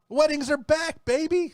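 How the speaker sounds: high, loud party hype; hyped-up announcement. Acted. DJ announcing the return of weddings, hyped-up excitement. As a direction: whoop it up like a party DJ.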